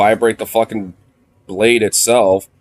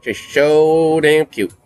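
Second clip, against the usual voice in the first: slow, higher, drawn-out gush; 7 semitones higher, much slower than usual. Real, not acted. Owner gushing over his dog, slow and drawn out. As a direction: stretch every word out in adoration.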